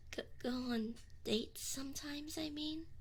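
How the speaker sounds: almost too shy to say it; shy whisper. Acted. Demon shyly proposing dates to summoner, whispered. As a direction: trail into a soft whisper.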